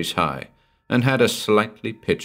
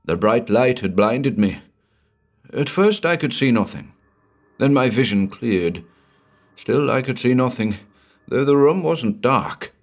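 a hint of a frightened old man, higher; lightly voiced shaken old rancher; 5 semitones higher than usual. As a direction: tell it like a rattled old man. Henry's voice in the story.

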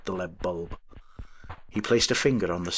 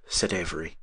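ordinary delivery, nothing marked

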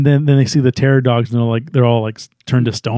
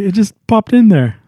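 higher-pitched, sheepish excuse; Ray; 6 semitones higher than usual. Retold or imitated. Ray admitting to the team what he thought of, sheepish. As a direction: confess it in a raised voice. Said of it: "and you see Ray".

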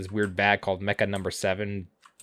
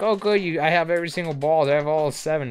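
higher gloating villain voice; mock Pilaf voice; 6 semitones higher than usual. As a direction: brag it up high like a smug little villain. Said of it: "Pilaf is like".

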